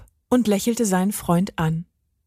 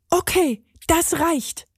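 higher, firm enough-is-enough; Benny fed up; 9 semitones higher than usual. A narrator's reading. Benny has heard enough, fed up. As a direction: cut it off, fed up, pitched up.

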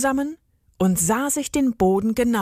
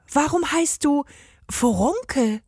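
put-on curious girl; 6 semitones higher than usual. A narrator's reading. Girl asks creature.

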